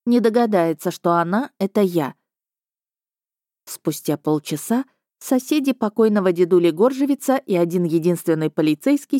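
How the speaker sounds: ordinary delivery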